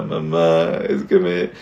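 hammed-up sobbing confession; mock crying daughter. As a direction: wail it to mom like an upset schoolgirl. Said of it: "la hija llorando con la mamá".

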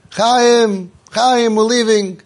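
put-on mother calling child to come. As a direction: call him down, mock shout.

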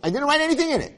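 squeaky high mock husband voice; mock clueless husband.

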